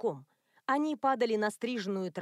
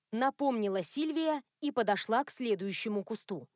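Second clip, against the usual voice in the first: plain reading voice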